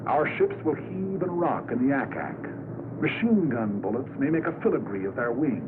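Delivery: ordinary talk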